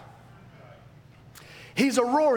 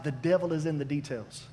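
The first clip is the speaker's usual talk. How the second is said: softer, dismissive quoting; mocking old saying; 7 semitones lower, 6 dB quieter than usual. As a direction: quote the cliché with scorn. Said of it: "really dumb statements like".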